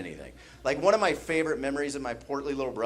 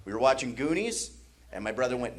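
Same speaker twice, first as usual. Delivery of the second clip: ordinary delivery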